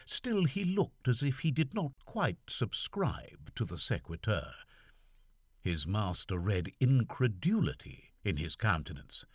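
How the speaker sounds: ordinary delivery, nothing marked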